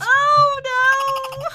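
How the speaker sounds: high, loud cry of dismay; alarmed cry. Real, not acted. Player reacting to the world being destroyed, alarmed cry. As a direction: cry out "oh no" as disaster hits. Game disaster strikes.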